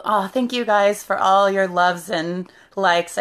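usual speaking voice

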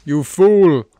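loud taunting yell of triumph; gleeful shout. Acted. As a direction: shout it like a villain gloating. Taunting a mob.

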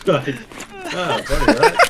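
talking through laughter; laughing. Real, not acted. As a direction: giggle through the words. Laughs off the mistake.

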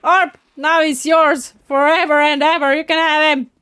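loud, high, urgent call; shouting to teammate. Real, not acted. Player hands an enemy over to Orb, shouting. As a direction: shout it across to your teammate.

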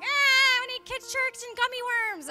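high, whiny mock-child voice; bratty kid. Preacher mimicking a kid demanding gummy worms, bratty. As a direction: wail it like a spoiled child. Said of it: "a bratty kid that's like".

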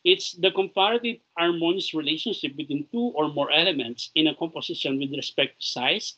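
ordinary talk, nothing marked

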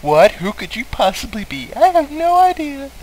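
very high airheaded voice; ditzy bimbo. Acted. Player mocks Archie as a clueless bimbo. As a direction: play it dumb and confused.

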